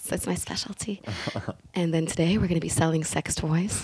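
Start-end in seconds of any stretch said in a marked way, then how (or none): 0.0-1.0 whisper. Real, not acted. Whispered joke.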